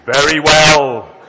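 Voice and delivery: huge, slow divine boom; very loud, drawn-out pronouncement. Acted. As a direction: thunder it from the heavens. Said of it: "boomed the voice".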